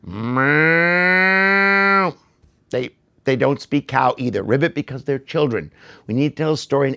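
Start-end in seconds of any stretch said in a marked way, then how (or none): 0.0-2.1 high drawn-out meow; a long cat's meow; playful meow. Pretend. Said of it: "try a different language? go ahead".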